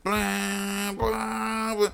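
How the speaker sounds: high, held synth-chord blares; vocalised chord stabs; imitated hard chords. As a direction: mimic blaring chords with the mouth. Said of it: "playing these hard chords".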